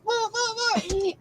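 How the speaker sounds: high-pitched mock whoa; retold alarm. Sewer's metal foot sticks to the magnet, mock alarm.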